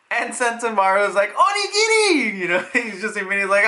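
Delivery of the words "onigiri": high-pitched gleeful shout; hammed-up excited squeal of a word; excited kid yell. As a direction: shout it like an overjoyed little kid. Kid's excited cry, retold.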